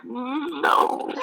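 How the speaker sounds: mock flat refusal. His inner refusal.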